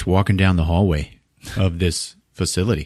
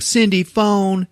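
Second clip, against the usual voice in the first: high, sing-out call through the house; drawn-out mock holler; 11 semitones higher, much slower than usual. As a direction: stretch it out to summon a housemate. Housemates call Cindy to the phone.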